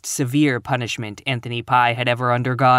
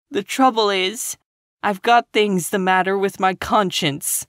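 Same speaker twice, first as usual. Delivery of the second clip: put-on higher, tearful girl's voice; sobbing; 7 semitones higher than usual. A narrator's reading. Girl confessing to Marilla, lightly sobbing. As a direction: push the words out through tears. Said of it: "sobbed Anne".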